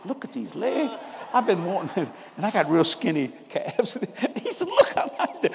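high voice breaking into laughter; giggling through the words, pitch jumping up; laughing while retelling. Real, not acted. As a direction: crack up while quoting your delighted brother. Retelling brother's delight, cracking up.

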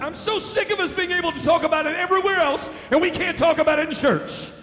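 full-force preaching. Acted. Preacher shouting his testimony to the church, fervent.

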